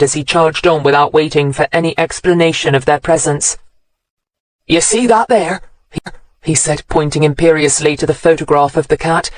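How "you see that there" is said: a higher, lightly voiced country accent; old countryman's accent. A narrator's reading. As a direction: point it out like a proud country old-timer. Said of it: "He had a lovely country accent".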